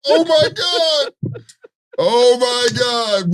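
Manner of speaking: drawn-out, very slow, high oh my God; slow, loud disbelief; stunned. Real, not acted. Laughing disbelief.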